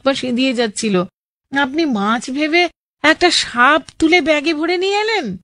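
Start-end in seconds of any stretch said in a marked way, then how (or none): 1.6-5.4 high-pitched incredulous exclamation; amazed reaction. Real, not acted. Host reacts to listener bagging a snake as fish, amazed. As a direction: react in disbelief, voice lifted high.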